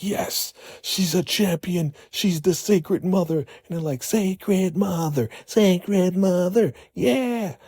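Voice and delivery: high, slow chanting devotee; a reverent high sing-song; fervent worship. Retold or imitated. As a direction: chant it like a swooning follower.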